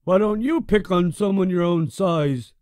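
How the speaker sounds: loud, high taunt thrown back; mock his words back at him; bully echoing Brother's line to mock him. A narrator's reading.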